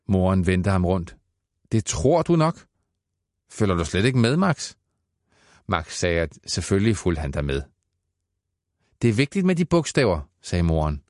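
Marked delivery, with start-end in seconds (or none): 1.7-4.8 higher, rising scolding mother; a mother pressing her son; mother questions son sharply, higher voice. Acted. 9.0-10.2 lecturing mother. Acted.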